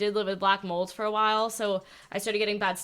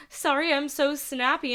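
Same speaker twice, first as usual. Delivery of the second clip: higher-pitched sheepish apology; retold apology; 6 semitones higher than usual. Boss saying sorry to her assistant, apologetic. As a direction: apologize in a raised, contrite voice. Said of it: "I've been apologizing to my assistant".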